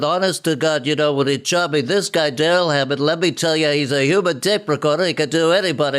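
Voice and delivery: lightly voiced Regis Philbin, genial talk-show host; a hint of Regis signing off, chummy and chatty; Regis Philbin impression. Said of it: "went into Regis".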